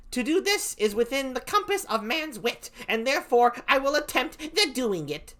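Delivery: high, silly clown voice; a lightly voiced high foolish clown. Clown character voiced in reading.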